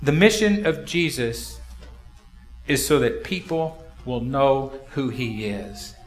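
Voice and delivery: normal talking voice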